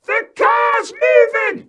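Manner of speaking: put-on very high, drawn-out child scream. Small boy screaming from the rolling car, shrieking. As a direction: shriek the alarm at full volume. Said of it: "He's screaming".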